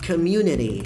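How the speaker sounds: community with the T tapped like r, comiuneri; light final T. Shown as a demonstration. Teacher models the word for students, light tapped T. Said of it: "com esse som de T bem leve no final".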